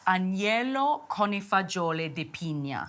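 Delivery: Italian name in an Italian accent. Shown as a demonstration. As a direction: say it like a native Italian. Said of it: "your Italian accent is perfection".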